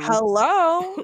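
loud, high, drawn-out hello; incredulous. Real, not acted. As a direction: call it out like a wake-up call in disbelief. Reacting incredulously to the widow's joke.

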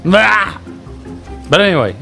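usual speaking voice

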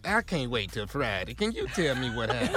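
grandmother voice. Retold or imitated. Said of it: "grandmama calling me".

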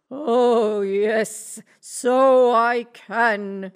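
loud, very slow boastful ogre. Acted. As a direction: brag like a vain giant. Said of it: "said the ogre".

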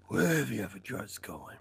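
low, soft scary voice; Batman. Retold or imitated. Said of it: "That's scary".

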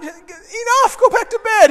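high, loud irritated scolding; exasperated shout. Retold or imitated. Sleepy student sending the boy away, exasperated shout. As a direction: snap loudly at a pestering kid.

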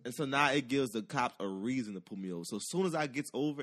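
ordinary delivery

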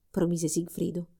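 usual speaking voice

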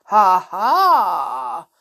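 loud, drawn-out "ha ha"; acted crocodile cackle; villainous laugh. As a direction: gloat with a slow laugh. Crocodile gloats.

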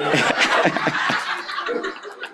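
helpless laugh at own confession; amused, wheezy laughter. Real, not acted. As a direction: break off into a breathy laugh.

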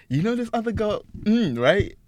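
high teasing friend voice, lightly done. Retold or imitated. Retelling a friend's tease at a club, high and sly. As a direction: mimic the friend's sly question.

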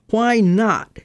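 sharp, high, loud challenge; demanding. A narrator's reading. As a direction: fire the question back at him. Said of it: "he demanded".

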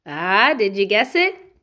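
usual speaking voice

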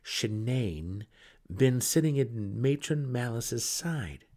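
high, bitter, slowing at the end; angry pressing. Acted. Brother resentfully asks his sister about the favourite. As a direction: drag out the grievance. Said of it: "he pressed".

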